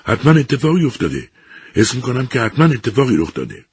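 worried husband insisting to the professor; restless dread. A narrator's reading. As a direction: sure something terrible has happened. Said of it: "ایزمانی ناآرام بود".

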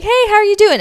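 high, bright cheerful hello; friendly greeting. Retold or imitated. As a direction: greet them like a happy old friend. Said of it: "smiling, waving".

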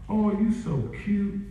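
high, slow mock cooing; cooing admirer. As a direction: coo as if charmed by something cute. Cooing over cute little devil.

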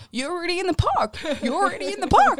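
high, loud robotic repeat of the error; glitching machine voice. Retold or imitated. Scanner spazzing out at the twins, loud robotic voice. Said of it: "spazzes out and goes".

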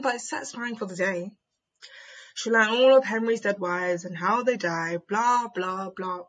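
ordinary talk, nothing marked

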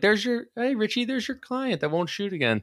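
high, hammed-up British accent; a mocking fake British voice; clumsy British accent again; bad British accent. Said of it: "That was a terrible British accent".

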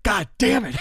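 high, loud frustrated outburst; angry curse. Real, not acted. Waking from the dream, furious high curse. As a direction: spit it out in sudden rage. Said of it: "I just woke up angry again".

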